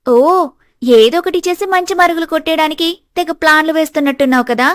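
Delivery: high, mocking accusation; scheming daughter, taunting.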